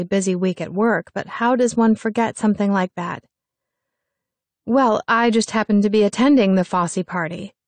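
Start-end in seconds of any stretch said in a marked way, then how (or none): none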